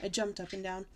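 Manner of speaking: ordinary delivery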